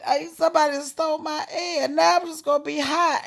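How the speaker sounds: hammed-up tearful yelling, loud and high; screaming and crying. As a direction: re-enact a kid wailing out a grievance. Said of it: "I'm screaming and crying".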